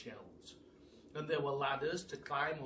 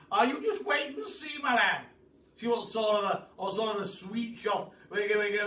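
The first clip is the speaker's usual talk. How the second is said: put-on loud, high, eager old gentleman; the Duke telling Billy they'll start work; excited Duke; 7 semitones higher, 12 dB louder than usual. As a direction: boom with delight. Duke excitedly plans the shop.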